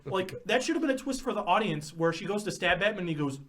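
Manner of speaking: normal talking voice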